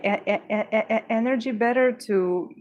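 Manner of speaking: stammering on the first sound; repeating a sound, stuck on the word; stuttering. Real, not acted. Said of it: "my stuttering is going to be worse than usual".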